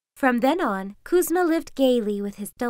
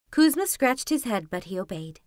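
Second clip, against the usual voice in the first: ordinary delivery, nothing marked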